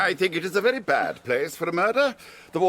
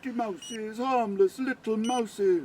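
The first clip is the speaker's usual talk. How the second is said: cooing to mice; 5 semitones higher, slower than usual. Acted. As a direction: coo over the little pets slowly. Count cooing to his pet mice.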